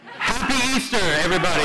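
high, loud cheer to the whole room; rousing Easter greeting. Real, not acted. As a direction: shout it to rally a sleepy crowd. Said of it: "this is like an every Sunday greeting".

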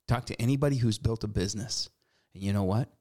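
ordinary delivery

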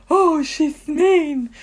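high, very slow mock whine; hammed-up self-pity; mock wounded sulk. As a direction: draw it out like a hurt, sulking boyfriend. Acting out Peter's wounded reaction.

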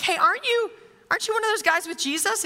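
lightly acted bystander calling someone out; suspicious bystander. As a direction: pipe up as a nosy onlooker. Voicing a bystander accosting Peter.

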